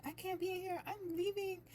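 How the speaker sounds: imitating tearful storming off. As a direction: retell her exit in a high, upset pitch. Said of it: "She was like".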